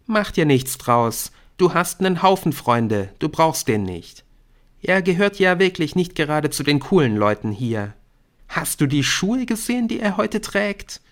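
put-on high girl's voice, gossiping; catty schoolgirl. A narrator's reading. Brynn disparages Jamie to his best friend, high and catty. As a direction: run him down in a high, girlish voice.

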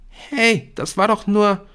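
put-on high, loud girl's protest; protesting schoolgirl. A narrator's reading. Brynn protests as Nick walks off, high and loud. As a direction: call after him, high and loud.

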